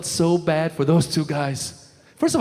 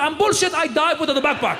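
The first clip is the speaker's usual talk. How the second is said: higher mock-frantic refusal; panicked refusal; 7 semitones higher than usual. Muhammad refusing the backpack: higher mock panic. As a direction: insist in comic panic.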